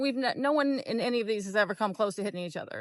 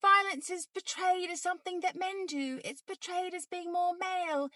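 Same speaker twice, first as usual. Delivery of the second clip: higher, laughing mock British accent; 8 semitones higher than usual. To the viewers, still mocking in a British accent. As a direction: keep the put-on British accent going, sarcastic. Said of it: "I don't know why I'm doing a British accent".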